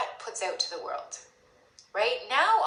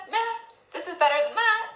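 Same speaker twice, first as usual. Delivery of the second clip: louder, higher shrugging meh; dismissive meh; 8 semitones higher, 5 dB louder, faster than usual. Pretend. Avoidant leader shrugging, loud dismissive meh. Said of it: "not the".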